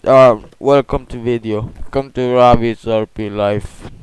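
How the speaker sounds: ordinary delivery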